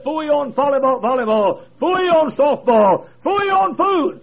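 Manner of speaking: high, slow scoffing phooey; the preachers dismissing games; scornful preachers. Retold or imitated. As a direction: brush it all aside with scorn. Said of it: "those preachers said".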